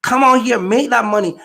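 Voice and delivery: usual speaking voice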